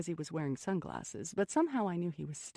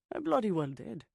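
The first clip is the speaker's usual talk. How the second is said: put-on light Scottish accent; 5 semitones higher, 4 dB louder than usual. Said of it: "a Scottish accent making music of his words".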